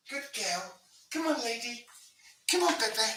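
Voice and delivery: high-pitched coaxing call from a distance; calling the dog. Real, not acted. Owner calling a pet dog in, high coaxing voice. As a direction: coax a pet over in a very high voice.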